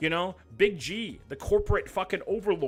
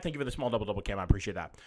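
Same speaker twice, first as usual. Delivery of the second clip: normal talking voice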